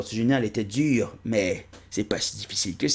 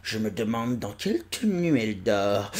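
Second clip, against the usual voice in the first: normal talking voice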